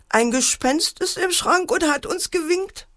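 high-pitched, lightly voiced scared child; frightened child. A narrator's reading. Scared child tells parents of the ghost, high-pitched. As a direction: report it high, with childlike alarm.

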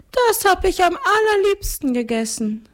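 very high ghost-count voice; gluttonous ghost count. Acted. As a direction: recount it as a high-pitched ghost nobleman. (hohe Stimme eines Gespenstergrafen)

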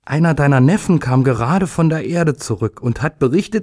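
high, animated news-breaking voice; excited announcement. A narrator's reading. Parents calling little day with his nephew's news, excited. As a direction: share the news eagerly, pitch lifted. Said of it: "riefen ihn seine Eltern".

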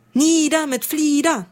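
rally the flock to fight; battle cry. Acted. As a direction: turquoise flock roaring at the violet ones. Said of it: "brüllte eines Morgens ein türkisfarbener Rabenschwarm".

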